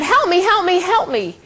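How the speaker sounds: high-pitched but restrained mock scream; retold scream for help. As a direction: imitate a resident crying out for help. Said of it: "the resident starts screaming out".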